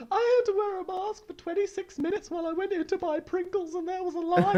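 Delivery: high whiny voice mocking a mask complainer. Host mocks crying anti-mask videos in a high whiny voice. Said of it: "of people being like".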